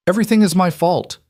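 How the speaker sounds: high, loud, voice breaking; voice cracking. A narrator's reading. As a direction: let the voice crack on it. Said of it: "Celine heard her voice crack".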